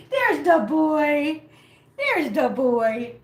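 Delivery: very slow, stretched-out pet talk; cooing greeting. Real, not acted. Owner greeting her boy cat, drawn-out cooing. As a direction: draw out each word for the pet, easing down.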